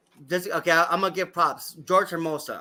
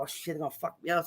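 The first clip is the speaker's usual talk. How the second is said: fast, soft panicked mutter; 9 dB quieter, faster than usual. Retold or imitated. Hiding in his car he mutters to himself, fast and soft with fear. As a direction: rush it under your breath, scared. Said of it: "I'm like".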